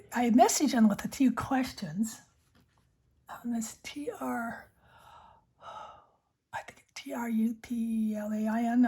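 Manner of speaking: unvoiced ASMR whisper. Real, not acted. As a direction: breathe the words close to the mic. Whispered ASMR pen chat.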